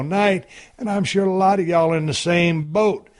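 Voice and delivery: upbeat podcast host reading an ad; punchy, rehearsed promotional read; sponsor ad read. Real, not acted. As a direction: sell it to the listeners in a friendly pitch.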